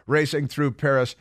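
ordinary delivery

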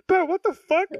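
high, loud mock-incredulous cry; shocked disbelief. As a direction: blurt it out in comic shock. Shocked retold reaction to the doctor.

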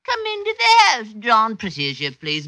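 mocking mimicry. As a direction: mimic her prissy tone cruelly. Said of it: "mocking echo of Angela".

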